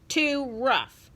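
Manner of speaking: high, loud, cross little protest; indignant little girl telling off a dog; growled complaint. A narrator's reading. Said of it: "she growled".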